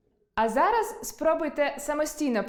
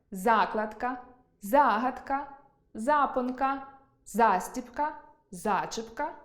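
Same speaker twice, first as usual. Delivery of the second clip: each word separate, first syllable «за» stressed; stress on prefix «за»; slower than usual. Shown as a demonstration. Teacher reading example words, stressing the first syllable «за». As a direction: punch «За» in «Закладка», «загадка», «запонка», then pause. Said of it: "будуть мати наголошений префікс «за»".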